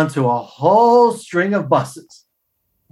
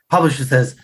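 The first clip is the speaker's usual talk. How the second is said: ordinary delivery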